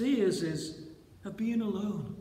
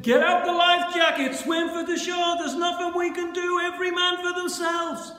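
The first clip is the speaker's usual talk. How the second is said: high, loud mock-frantic orders; sustained high-pitched alarm call; panicked shouting; 7 semitones higher, 4 dB louder than usual. Imagined panicked Jesus shouting orders.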